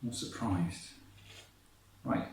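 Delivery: usual speaking voice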